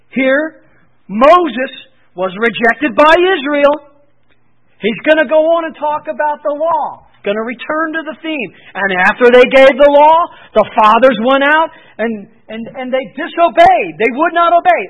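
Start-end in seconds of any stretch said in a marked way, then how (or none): 0.1-4.0 loud, driving "Moses was rejected"; forceful preaching. Acted. Preacher driving home Israel rejecting Moses, loud. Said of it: "preaching at full force". 8.7-14.8 loud, hammering; forceful preaching. Acted. Preacher thundering about disobedient fathers, loud.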